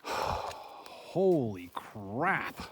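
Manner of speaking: sighing, very slow relieved exhale; real slow sighed relief; exhausted relief. Real, not acted. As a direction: breathe it out as the fear drains. Relief after the bear leaves.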